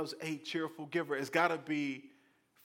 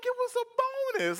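much higher, louder mock outrage; squeaky protest; 7 dB louder than usual. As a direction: squeal it in disbelief. Taxpayer protesting, voice jumps high.

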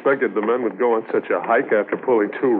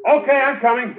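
higher, raised reply from a distance; calling back; 13 semitones higher than usual. Acted. Partner answering from across the field, raised call. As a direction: call back while hurrying over.

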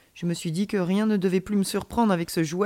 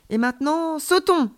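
high voice rising to a loud cry; excited signal; 8 semitones higher, 5 dB louder than usual. A narrator's reading. Alien child cues friend to jump, excited. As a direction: build up, then launch the last word.

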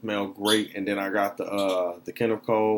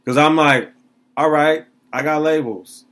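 confused; 4 semitones higher, 6 dB louder than usual. Retold or imitated. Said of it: "He looked very confused".

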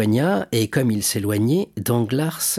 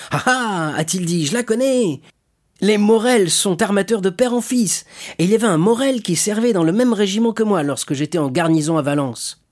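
higher-pitched, animated voice for a quoted emperor; 7 semitones higher, 3 dB louder than usual. Retold or imitated. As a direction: recall the family with lively recognition. Emperor's words quoted, pleased recognition. (voix animée de l'empereur rapportée)

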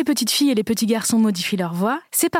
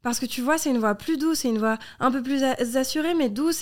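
higher, softer put-on voice to be liked; sweet, assured teenage interview voice; gently raised, eager-to-please tone; 3 semitones higher, 4 dB quieter than usual. Shown as a demonstration. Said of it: "je me mets à parler comme ça".

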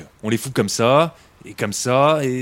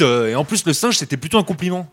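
usual speaking voice

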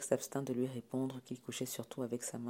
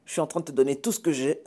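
raised, indignant parent's rant; angry scolding; 2 semitones higher, 7 dB louder than usual. Acted. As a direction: berate an ungrateful child, getting louder. Parent rants at ungrateful son. (réprimande indignée)

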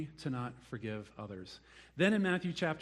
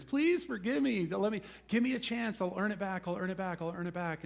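high begging voice, fading down; mock pleading servant; 5 semitones higher than usual. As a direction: plead desperately, then trail off. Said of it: "he pleads and he begs".

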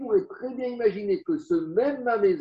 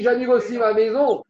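ironic; 5 semitones higher, 6 dB louder than usual. Retold or imitated. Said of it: "c'est de façon ironique".

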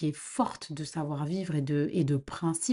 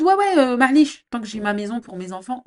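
mocking; 5 semitones higher, 10 dB louder than usual. Mocks people's careless attitude.

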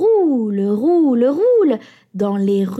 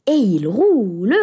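ordinary delivery

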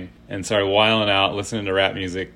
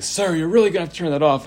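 higher, fast, stern flight attendant warning; flight attendant scolding; 10 semitones higher, 3 dB louder, faster than usual. Retold or imitated. Telling Fred on the plane to turn his music off, stern and fast. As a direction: rattle off a strict cabin-crew warning. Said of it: "he's like".

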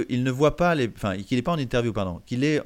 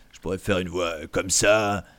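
lower, darker demo voice; grave demo voice; 4 semitones lower, 4 dB louder than usual. Shown as a demonstration. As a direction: drop into a deeper register. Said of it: "je pourrais faire une voix comme ça". (voix plus grave en démonstration)

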